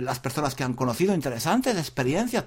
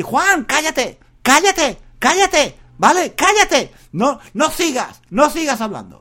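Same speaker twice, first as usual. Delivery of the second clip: higher, louder mock order to stop talking; shout to shut up; 9 semitones higher, 6 dB louder, slower than usual. Listeners ordering Juan to stop talking, loud mock command. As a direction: bark it like telling a chatterbox to shut up. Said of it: "me tenéis que decir".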